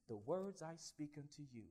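hushed, slow whisper; whispered scripture. Retold or imitated. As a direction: breathe the words of Jesus. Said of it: "and Jesus says".

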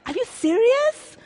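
mock outrage at the whole church singing; incredulous. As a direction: ask it as if they can't possibly mean it. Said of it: "I'll look at everyone around me and I'll be like".

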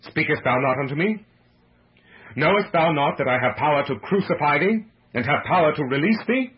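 loud, high, haughty questioning. A narrator's reading. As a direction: demand an answer as an offended ruler. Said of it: "Pilate said haughtily".

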